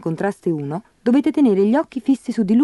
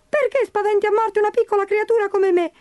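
high, tiny creature voice crying out; frightened leprechaun cry; 13 semitones higher than usual. Acted. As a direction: squeak out in fear like a little leprechaun. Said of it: "gridò il leprecano". (voce acuta e spaventata del folletto)

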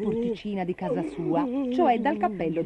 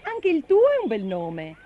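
higher, lively, encouraging voice; loud, chipper compliment; friendly reply; 5 semitones higher, 6 dB louder than usual. Acted. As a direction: answer brightly, without dragging. Bastoncello cheerily answers gloomy Ugo.

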